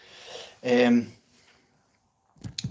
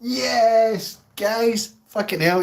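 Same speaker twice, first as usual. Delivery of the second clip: excited outburst; 9 semitones higher, much slower than usual. Real, not acted.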